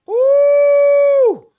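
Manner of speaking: high, loud, squealed diva ooh; a shrieked ooh. Acted. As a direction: let out a big showy ooh. Diva hyping herself up.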